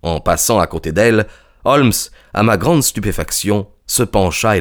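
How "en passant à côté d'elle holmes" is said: usual speaking voice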